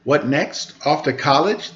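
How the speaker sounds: plain reading voice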